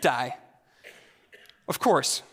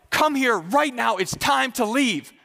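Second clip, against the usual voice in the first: slow, higher, insistent command; stern mom imitation; 4 semitones higher, slower than usual. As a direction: order the kid over, word by word. Mom orders defiant son, retold.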